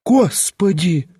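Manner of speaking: high, slow cry of shock; shocked exclamation. A narrator's reading. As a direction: throw up your hands and cry out. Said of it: "всплеснула она руками".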